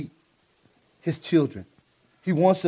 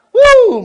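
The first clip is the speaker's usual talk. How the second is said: a loud, high celebratory whoop; joyful whoop; 16 dB louder than usual. Real, not acted. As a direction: let out a big happy Woo. Preacher's joyful shout.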